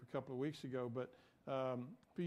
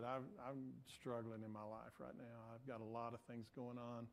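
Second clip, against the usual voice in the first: low, much softer, subdued admission; imitating tearful confession; 5 semitones lower, 12 dB quieter than usual. Woman telling a stranger her life is hard, subdued. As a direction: quietly retell someone's painful confession. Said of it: "she just started crying".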